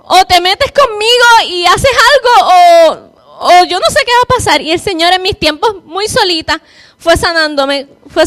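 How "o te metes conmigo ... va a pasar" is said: tearful desperation. Real, not acted. Desperate ultimatum to God.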